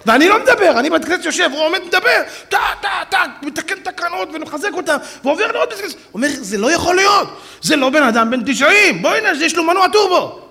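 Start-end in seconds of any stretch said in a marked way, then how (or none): 0.1-5.9 high, loud, breathless amazement; marvelling loud retell; excited retelling. Retold or imitated. Excited retelling of the rabbi's pace. 6.4-10.4 high, loud astonished cry; loud amazed shout; amazed exclamation. Retold or imitated. Amazed exclamation about the rabbi.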